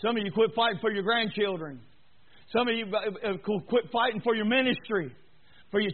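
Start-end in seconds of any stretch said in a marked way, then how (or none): none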